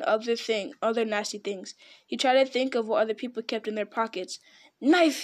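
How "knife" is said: high, louder one-word guess; Gollum. A narrator's reading. Creature guessing at last, blurted. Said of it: "he said at last".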